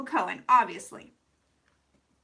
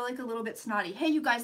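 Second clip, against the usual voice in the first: normal talking voice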